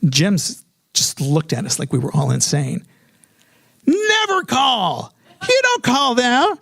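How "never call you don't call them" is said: high, drawn-out emphatic pronouncement; hammed-up, self-assured friend laying down the law; emphatic friend's edict. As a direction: call out each rule slowly at a high pitch. Jim's know-it-all dismissive advice.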